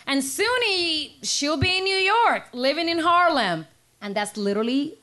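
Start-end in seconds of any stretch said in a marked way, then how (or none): 0.1-3.7 slow, high announcing voice. Retold or imitated. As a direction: announce it like a class president. Class president reading predictions.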